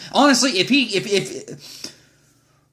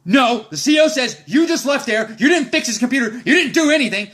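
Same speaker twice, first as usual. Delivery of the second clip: mock gunny argument; 5 semitones higher than usual. Gunny arguing, retold.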